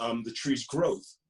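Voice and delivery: plain reading voice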